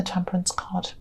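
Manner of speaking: soft whispered aside. Real, not acted. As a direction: let it fade to a whisper. Card name trailing into whisper.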